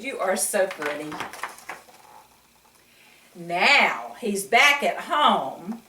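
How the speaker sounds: ordinary delivery, nothing marked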